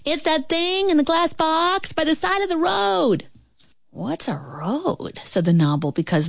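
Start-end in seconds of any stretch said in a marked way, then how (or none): 0.0-3.3 put-on high, sustained girl's call; high-pitched long-distance holler; shouting. A narrator's reading. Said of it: "the little girl shouted". 3.9-5.2 soft, slow, baffled question; hushed bewilderment; puzzled. A narrator's reading. Baffled Nobble asks softly.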